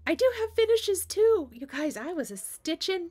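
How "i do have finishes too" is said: high, loud and thrilled; excited. Real, not acted. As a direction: burst out with happy news. Announcing her finished projects.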